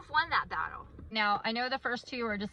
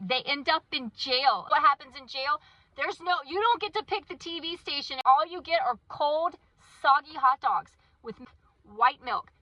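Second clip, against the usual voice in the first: loud, hammed-up parental warning; ranting parent, playfully over-dramatic; mock scare lecture; 4 semitones higher, 8 dB louder than usual. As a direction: scare a kid straight, raised and emphatic. Acted parent scaring kid with jail.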